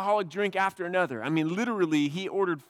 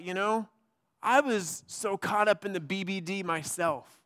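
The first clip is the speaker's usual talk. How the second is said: normal talking voice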